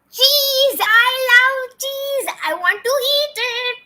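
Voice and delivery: high, slow, drawn-out squeal of delight; playful gushing. Pretend. Teacher confessing her love of cheese, playful high gushing. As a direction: gush loudly, stretching every word high.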